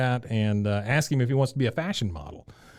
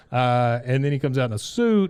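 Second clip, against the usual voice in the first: usual speaking voice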